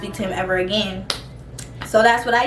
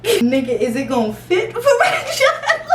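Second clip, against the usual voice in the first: laughing disbelief; 10 semitones higher than usual. Retold or imitated. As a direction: laugh through the question in disbelief.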